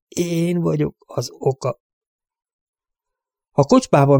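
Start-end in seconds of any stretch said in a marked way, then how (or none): 0.1-1.8 slow, softer, higher-pitched self-blame; quiet, stricken confession. A narrator's reading. Broken father insisting on his guilt, quiet stricken confession. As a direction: draw out ÉN, quietly taking the guilt.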